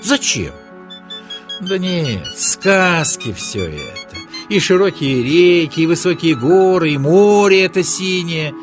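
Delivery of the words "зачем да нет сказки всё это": usual speaking voice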